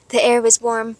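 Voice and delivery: ordinary delivery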